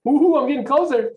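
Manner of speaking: high, loud, thrilled gasp of delight; excited. Pretend. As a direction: sound giddy that the next yes is near. Imagined agent excited after a no.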